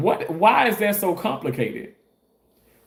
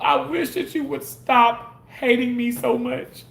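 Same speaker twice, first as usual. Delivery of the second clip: usual speaking voice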